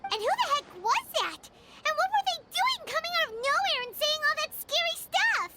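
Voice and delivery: spooked. Acted. Paimon spooked by the voice.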